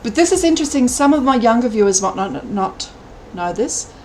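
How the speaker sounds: ordinary talk, nothing marked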